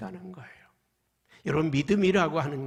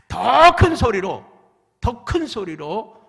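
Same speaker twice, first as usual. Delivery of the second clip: slow, loud, rising call; building shout; 6 semitones higher, 14 dB louder, slower than usual. Acted. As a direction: push it louder each time. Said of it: "소리쳤습니다". (점점 더 크게 외치듯)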